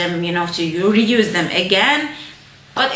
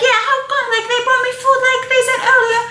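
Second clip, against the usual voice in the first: high, fast mock whine; squeaky entitled complaint; demanding passenger; 14 semitones higher, 3 dB louder, much faster than usual. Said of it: "She's like".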